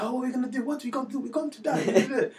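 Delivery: hammed-up panic in a doomed bank robbery; mock panic. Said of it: "and I'm like".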